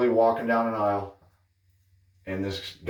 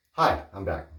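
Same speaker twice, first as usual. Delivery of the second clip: usual speaking voice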